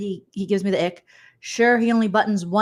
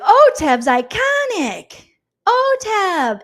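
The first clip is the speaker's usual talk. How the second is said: much higher and louder, slow; mock thrilled; 11 semitones higher, 7 dB louder, much slower than usual. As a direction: gush over the comp name. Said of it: "They're like".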